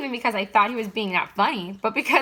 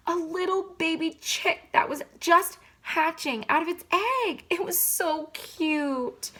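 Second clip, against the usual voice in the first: gushing; 9 semitones higher, slower than usual. Acted. Mom gushing over baby chick.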